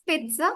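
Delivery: "pizza" as PIT-sa, with a ts sound; correct pronunciation. Shown as a demonstration. Teacher models the word for a learner, correct pronunciation. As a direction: say "pizza" in two parts, pit then a light sa. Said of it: "focusing on the former syllable of it, pit".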